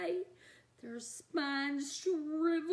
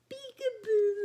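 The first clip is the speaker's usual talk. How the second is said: high, louder sing-song peekaboo; playful peekaboo; 8 semitones higher, 6 dB louder than usual. Pretend. Reader acting Little Shock's peekaboo game, high and playful. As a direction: coo it like playing with a baby.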